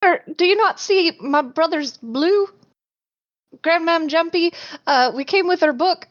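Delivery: high, fast, breathless apology; deferential apologetic girl. Acted. Changeling sister apologizing to the stump creature, high and flustered. Changeling keeps pleading in character.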